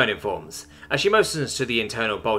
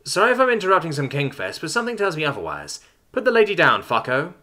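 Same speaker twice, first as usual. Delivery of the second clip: higher voice building to a firm order; commanding interruption; 6 semitones higher than usual. A narrator's reading. Terran ordering an attacker off a hostage, commanding. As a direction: interrupt coolly, then order sharply. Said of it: "Simone orders the Death Welder".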